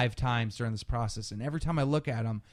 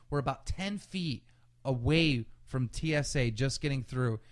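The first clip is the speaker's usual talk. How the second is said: normal talking voice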